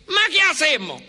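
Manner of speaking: high, loud, exasperated Italian outburst; angry Italian. Retold or imitated. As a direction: throw up your hands like a furious Italian. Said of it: "piensen en un italiano enojado".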